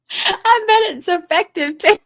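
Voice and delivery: high, slow words breaking into laughter; cracking up. Real, not acted. Streamer adding to a viewer's joke, cracking up. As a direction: squeeze the joke out, then burst out laughing.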